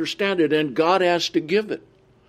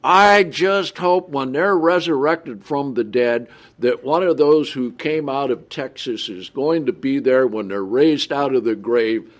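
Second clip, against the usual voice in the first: ordinary delivery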